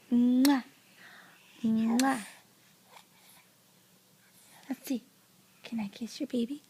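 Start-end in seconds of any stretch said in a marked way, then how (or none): 0.1-2.2 between kisses. Shown as a demonstration. Mother kisses the doll. 5.6-6.6 whispering. Real, not acted. Coaxing toddler softly.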